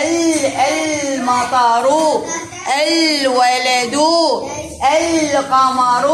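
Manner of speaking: "ال، المطر، الولد، القمر" slowly, each lam sounded as a clear l; pronounced lam. Shown as a demonstration. Teacher shows the moon lam in "القمر", slow with the l sounded. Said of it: "ننطقها".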